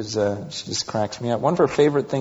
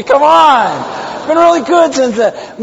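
high, loud playful protest; 13 semitones higher, 13 dB louder, slower than usual. Real, not acted. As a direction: defend yourself loudly with a grin. Protesting to audience, laughing.

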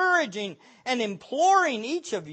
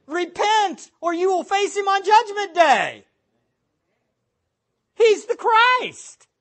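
higher, louder fiery preaching; preaching at full force; 9 semitones higher, 6 dB louder than usual. Acted. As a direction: thunder the call to repent.

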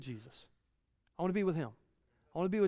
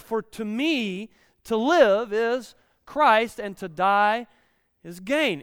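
fervent scripture reading; 3 semitones higher, 8 dB louder than usual. Acted. Reading Paul aloud with force.

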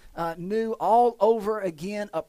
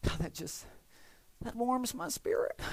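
hushed, sappy mock reverence; softly gush, sending up a rosy sermon; mock flowery piety; 2 semitones higher, 9 dB quieter than usual. Preacher mimicking fans of flowery messages, hushed mock-pious. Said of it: "There's some messages that are just flowery".

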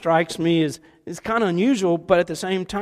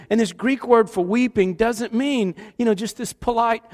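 usual speaking voice

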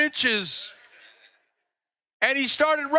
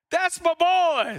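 high, slow proud yell; proud dad yelling; 7 semitones higher, slower than usual. Retold or imitated. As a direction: call it across the field like a proud dad. Dad screaming, repeated.

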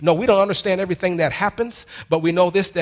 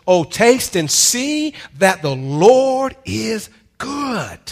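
slow, loud, high proclaiming shout; preaching shout; 5 semitones higher, 5 dB louder, much slower than usual. Acted. Preacher proclaiming the psalm to the congregation, slow loud shout. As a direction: drag every word out to the back row.

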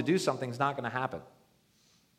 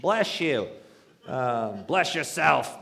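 mock brush-off greeter; 6 dB louder, slower than usual. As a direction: loudly wave the churchgoers away. Crass greeter brushing people off.

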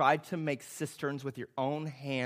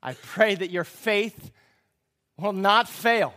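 forceful proclamation; 7 semitones higher, 12 dB louder, slower than usual. Acted. Jesus' prayer quoted at full force.

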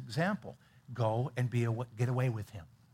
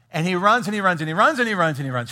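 each runs pushed louder and higher; emphatic repetition; 4 semitones higher, 7 dB louder, faster than usual. A narrator's reading. Preacher stressing how far Elijah ran, louder and higher. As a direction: drive the repeated runs home. Building retelling of Elijah running.

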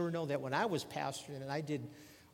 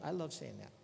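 ordinary talk

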